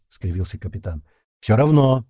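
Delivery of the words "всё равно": louder, mildly stubborn insistence. A narrator's reading. Zhenka refuses to abandon his friends, stubborn. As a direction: dig your heels in. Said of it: "упрямо покачал головой Женька".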